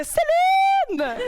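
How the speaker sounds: loud, high, long-held name; hammed-up high holler of a name; hammed-up cry. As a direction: belt out the star's name, stretching it. Hammed-up star name for laughs.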